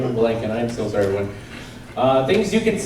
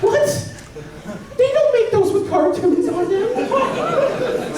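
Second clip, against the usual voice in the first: laughing; slower than usual. Real, not acted. Incredulous through laughter.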